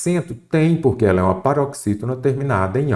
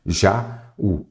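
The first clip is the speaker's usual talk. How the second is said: "já, u" as two separate beats; split into syllables. Shown as a demonstration. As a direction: clear pause between "já" and "u". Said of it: "uma sílaba, outra sílaba".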